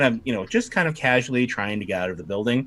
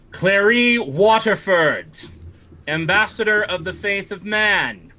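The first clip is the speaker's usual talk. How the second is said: imperious Night Lord; 7 semitones higher than usual. Acted. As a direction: summon her by name like a stern official.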